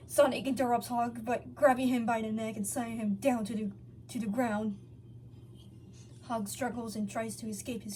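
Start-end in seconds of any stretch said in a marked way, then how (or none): none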